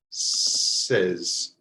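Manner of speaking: "says" said low with a short e, like res; model word. Shown as a demonstration. Teacher modeling "says" before learners try it. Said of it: "Try it out".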